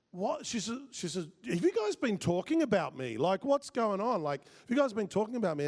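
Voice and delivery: high, incredulous questioning; confused. Retold or imitated. Office lady finding she was discussed, confused. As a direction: imitate a baffled staffer demanding an explanation. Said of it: "like, confused".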